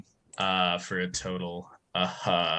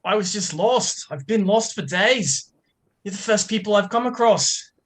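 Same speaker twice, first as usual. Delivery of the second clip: much higher, small and pleading; pitiful lost wanderer; 13 semitones higher, 4 dB louder than usual. Acted. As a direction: play a tiny lost soul begging for sympathy. Player voices Raptor.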